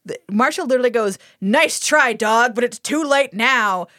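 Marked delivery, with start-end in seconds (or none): 1.4-3.9 punchy opening, drawn-out finish; hammed-up gloating retort; gloating villain. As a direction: taunt the dog like a triumphant villain. Mimicking gloating villain Marshall.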